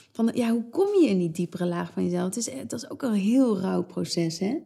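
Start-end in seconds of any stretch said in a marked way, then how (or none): none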